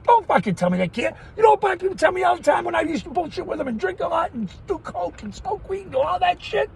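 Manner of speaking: ordinary talk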